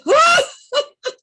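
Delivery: high, loud hearty guffaw; store owner's laugh. Retold or imitated. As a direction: burst out laughing at them. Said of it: "He started laughing like this".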